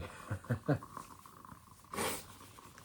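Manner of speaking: real laugh through tears; tearful laugh. Real, not acted. Tarot reader embarrassed by own tears, tearful laugh. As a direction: laugh while actually crying. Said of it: "I'm actually crying".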